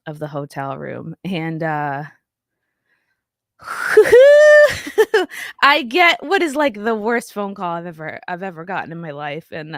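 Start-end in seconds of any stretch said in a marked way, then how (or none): 3.6-5.6 a sudden high crying gasp; a sob breaking out, real; choked cry. Real, not acted. Recalling worst phone call.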